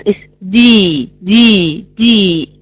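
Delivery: D with no puff of air, voiced; unaspirated D. Shown as a demonstration. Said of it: "the other one doesn't".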